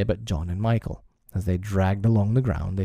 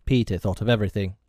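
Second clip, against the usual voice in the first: plain reading voice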